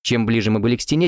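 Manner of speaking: plain reading voice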